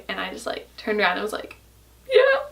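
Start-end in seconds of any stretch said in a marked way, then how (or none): 2.1-2.4 tiny, very high mock-tearful squeak; imitated tearful reply. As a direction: squeak it out as if about to bawl. Retelling tearful answer to dad.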